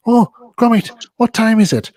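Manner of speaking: high, loud Northern English inventor voice; Wallace impression. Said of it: "and then he says".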